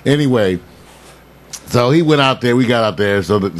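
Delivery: ordinary talk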